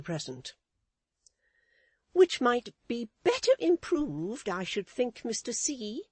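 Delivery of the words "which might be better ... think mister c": much higher, prim lady's voice; Missus Chick's voice. A narrator's reading. Wife lecturing her husband, in a high prim voice. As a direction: preach a lesson, high and proper. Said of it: "retorted his helpmate".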